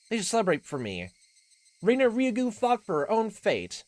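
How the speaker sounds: loud, high declaration; proud game heroine. Acted. Reina declaring her struggle to friends, proud. Voices game character's lines.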